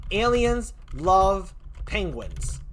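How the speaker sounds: aliens love penguins, each word drawn out very slowly; slow emphatic takeaway. Shown as a demonstration. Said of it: "just one word I had to take away".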